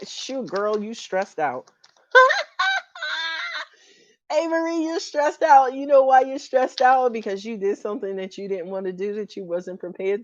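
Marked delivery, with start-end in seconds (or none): 2.1-3.6 shrill burst of laughter, way up high; high squealing laugh. Real, not acted. Cracks up at reading. 4.3-7.1 teasing call out. Real, not acted. Teasing viewer loudly.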